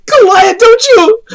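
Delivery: very high, tearful pleading; hammed-up crying. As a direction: wail accusing questions as a distraught girl. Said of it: "they're all just crying".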